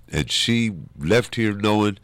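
ordinary talk

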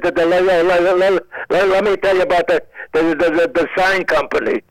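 comic stutter, stuck on "le-, le-" and "the, the"; imitated stutter. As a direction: trip repeatedly on "le-" and "the" before getting out "the sign company". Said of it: "she stuttered very badly".

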